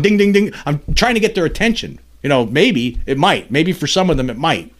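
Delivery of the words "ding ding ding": loud, high mock bell ringing; bell noise. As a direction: chant the bell sounds to wake them up. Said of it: "you're ringing a bell here".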